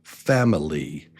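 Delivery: Family as FAM-i-ly; Family, strong start and falling end; one strong beat. Shown as a demonstration. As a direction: stress FAM in Family, drop the rest. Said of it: "just one strong beat".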